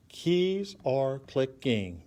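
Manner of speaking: slow "Keys are clicking", each syllable its own beat. Shown as a demonstration. Teacher checks the syllable count of a poem line, syllable by syllable. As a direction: break "clicking" into click, ing, evenly spaced. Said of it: "a syllable is kinda like a beat".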